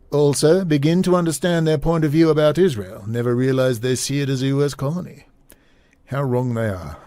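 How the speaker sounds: badly faked British royal accent; Prince Charles. Host resuming the prince's letter after apologising, bad Prince Charles voice. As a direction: read it as a letter in a put-on British voice. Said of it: "Prince Charles voice".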